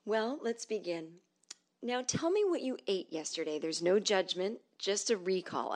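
imitated clinical hush; mock voice therapist. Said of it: "And she goes".

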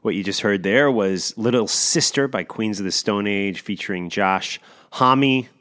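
usual speaking voice

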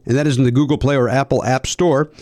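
normal talking voice

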